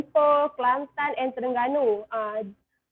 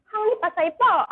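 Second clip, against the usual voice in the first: Kedah dialect, pitched higher and a bit blunt; 5 semitones higher than usual. Shown as a demonstration. Showing outsiders how Pendang folk ask, blunt Kedah accent. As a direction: toss the question off the rough Kedah way, higher. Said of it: "But in my dialect ... we say ... kinda rude lah".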